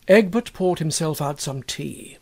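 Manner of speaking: plain reading voice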